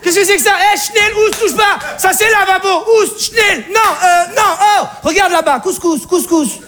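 put-on very high shrieking old woman shooing him away; panicked Alsatian mother. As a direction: scream at the stranger at the sink. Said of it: "la mère alsacienne arrive". (la mère alsacienne paniquée, cris très aigus)

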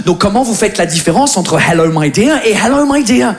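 normal talking voice

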